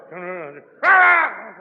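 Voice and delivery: rising into a high, loud mock yell; mock the sponsor's yelling; mock ranting. Speaker parodying his sponsor's yelling, loud mock rant. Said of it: "He always talked".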